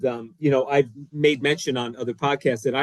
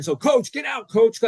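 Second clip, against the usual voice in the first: higher, official telling him off; official's voice; 7 semitones higher than usual. Retold or imitated. Official orders the coach off during a timeout, higher voice. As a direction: shoo the coach off the field. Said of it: "the official comes in".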